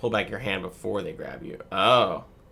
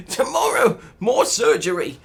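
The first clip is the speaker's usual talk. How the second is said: much higher, louder put-on accent as a sick patient; whiny patient voice; 12 semitones higher, 6 dB louder, slower than usual. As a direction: whine like a child after surgery. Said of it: "What is this accent?".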